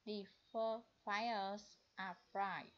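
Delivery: "four fires are bright" read slowly, word by word; slow model sentence. Shown as a demonstration. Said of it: "em sẽ đọc là bright". (đọc chậm câu mẫu)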